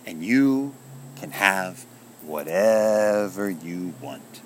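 very slow, drawn-out grand proclamation; proclaiming shout. A narrator's reading. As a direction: stretch each word like a magical announcement, then drop away. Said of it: "the rooster shouted".

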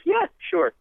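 high, light attempt at a British accent; a campy stab at a Liverpool voice. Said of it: "I can't do a British accent".

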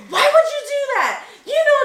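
high-pitched mock scolding; scolding teacher. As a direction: demand an answer like an upset teacher. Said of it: "She's like".

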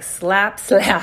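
loud mock slaps; playful slap. Virgo energy slapping the know-it-all self, loud and teasing. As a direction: smack it out, teasing. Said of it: "Virgo energy is like".

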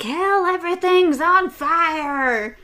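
high, loud, drawn-out holler; hammed-up cry of alarm; mock alarmed shout. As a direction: burst in hollering about an emergency. Someone bursts in, mock alarm.

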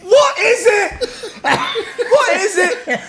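very high, loud panicked wail; hammed-up terror, last words stretched right out; mock panic. Drugged friend asking what he has taken, mock panic.

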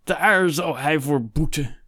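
high, drawn-out outraged vow; slow, seething, wounded pride; indignant outburst. A narrator's reading. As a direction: declaim the threat until the breath fails. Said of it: "Zijn adem stokte".

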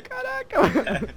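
high-pitched shocked exclamation. Retold or imitated. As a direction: gasp it out, stunned. Shocked reaction, retold.

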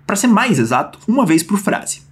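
usual speaking voice

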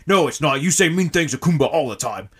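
loud, high creature shouting; angry broken English. Acted. Kumba yelling in quarrel.

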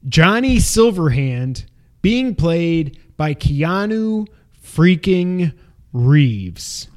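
slow, every word stretched out; drawn-out dramatic reveal. Real, not acted. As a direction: drag out Keanu freaking Reeves for effect.

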